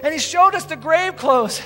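women rushing to tell the disciples; breathless excitement. Acted. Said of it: "they're going".

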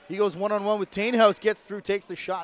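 live one-on-one call, real excitement; excited play call. Real, not acted. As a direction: lift the voice as the attack breaks. Calling a one-on-one attack.

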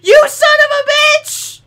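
very high, loud, outraged yell; furious shout. Real, not acted. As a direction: scream at the train in fury. Train misses him again.

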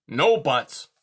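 loud, sharp snap; snapped. A narrator's reading. Porcupine cuts off Poppy's protest, snapping loudly. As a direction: cut her off hard. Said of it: "Irith snapped".

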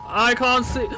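ordinary talk

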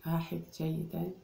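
ordinary talk, nothing marked

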